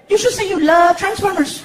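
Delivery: put-on high, loud child voice; little kid. Son advising his dad, high eager voice.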